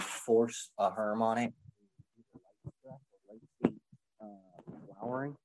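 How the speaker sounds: muffled behind a hand; covered mic. Real, not acted. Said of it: "I think you're covering your mic".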